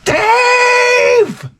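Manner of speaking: high mock shout for Dave; calling out. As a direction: yell across the store for Dave. Mother calls out for husband.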